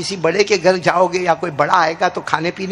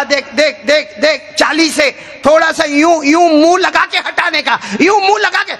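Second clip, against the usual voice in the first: anxious urging; 7 semitones higher than usual. Acted. Abu Hurairah urges the companion to take only a sip, rising anxiously. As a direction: build from fretting to near-shout.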